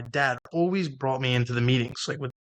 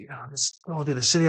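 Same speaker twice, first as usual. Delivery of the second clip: retold excitement; 2 semitones higher than usual. Retelling tipsy ride through city.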